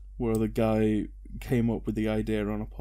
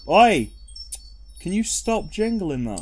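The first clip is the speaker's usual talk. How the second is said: loud, higher "oi"; sharp call-out; 7 semitones higher, 6 dB louder than usual. Real, not acted. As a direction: call out sharply to stop someone. Calls out to stop noise.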